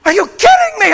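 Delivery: overwhelmed pastor yelling down the phone; screaming. Retold or imitated. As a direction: shout it, overwhelmed. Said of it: "I'm screaming at this poor lady".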